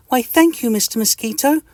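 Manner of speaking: high, cheery tiny-creature greeting; friendly little itch. Acted. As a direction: greet your neighbour brightly and squeakily. Mister Itch voice.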